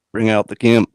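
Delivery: mock American delivery; American accent. Shown as a demonstration. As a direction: attempt an American movie-quote accent. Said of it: "I'd like to hear your American accent".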